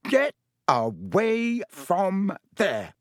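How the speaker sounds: high, very slow indignant command; outraged order. A narrator's reading. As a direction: space out each word in high outrage. Percy swells and orders them away.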